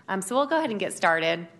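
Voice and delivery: projecting loudly over the room without a mic. Real, not acted. Presenter projecting to the council without a working mic, loud. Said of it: "I have a loud voice".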